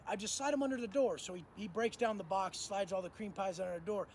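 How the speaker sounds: ordinary delivery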